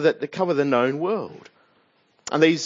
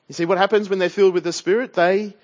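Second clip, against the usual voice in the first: ordinary talk, nothing marked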